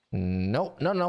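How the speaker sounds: ordinary delivery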